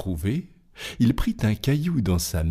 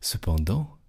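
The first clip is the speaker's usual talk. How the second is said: ordinary delivery, nothing marked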